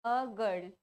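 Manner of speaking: "अ girl" said low, article as "uh"; article pronunciation demo. Shown as a demonstration. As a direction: give "अ" an uh sound before "girl". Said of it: "क्या बोलेंगे?".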